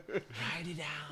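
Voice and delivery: eerie tree echo. Acted. Tree echoes, slow and eerie.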